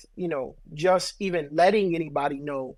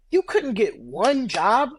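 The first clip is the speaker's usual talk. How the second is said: slow, higher-pitched mock disbelief; incredulous reproach; 6 semitones higher, 4 dB louder, slower than usual. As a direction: ask it slowly, as an incredulous reproach. Acted reproach to an unsuccessful other.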